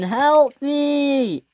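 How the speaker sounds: high-pitched plea for rescue; cry for help. Acted. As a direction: call out high for someone to save you. Floating away, crying for help.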